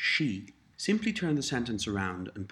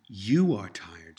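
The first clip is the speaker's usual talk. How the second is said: "You" punched louder at the start; stress on you; 4 dB louder than usual. Shown as a demonstration. Teacher prompting the learner, louder stress on you. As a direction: hit the word "You" hard. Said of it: "stressing you".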